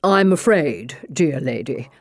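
a composed, skeptical English detective; inspector voice. Acted.

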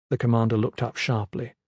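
ordinary delivery

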